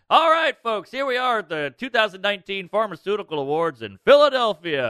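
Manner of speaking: loud, high emcee opening; mock-cheery host greeting the room; hammed-up stage announcement; awards show host. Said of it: "I have to go back up".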